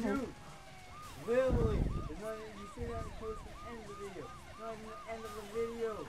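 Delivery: squeaky high indignant voice; high-pitched protest. Real, not acted. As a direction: shoot way up in pitch.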